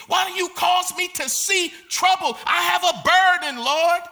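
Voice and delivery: loud, high pleading shouts to God; crying out to God. Retold or imitated. Prophet crying out to God over violence, shouted plea. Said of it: "he's crying out to God".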